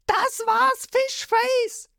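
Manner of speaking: very high shrill battle cry; boy's yell. A narrator's reading. The boy tries to stab Lysop, very high yell. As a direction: scream it as you strike. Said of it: "schreit dabei".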